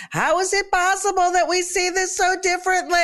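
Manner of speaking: high, hammed-up wail of exasperation; frustrated mock scream. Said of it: "pull your hair out in frustration and scream".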